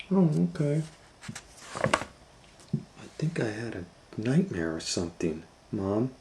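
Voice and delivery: soft, relieved. A narrator's reading. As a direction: sigh it out. Kate relieved.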